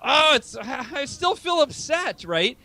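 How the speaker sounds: a mock-upset whimper, drawn out; upset inner voice. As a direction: whine it out like you're still stuck. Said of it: "You're like".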